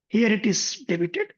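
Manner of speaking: usual speaking voice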